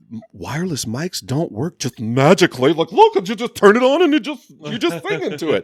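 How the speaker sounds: high, loud, mocking instructor voice; sarcastic tutorial for a clueless thief; mocking know-it-all. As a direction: talk down like explaining to an idiot.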